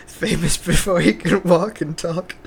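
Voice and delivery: lightly voiced old wizard, slowly; Dumbledore voice. Dumbledore voice attempt.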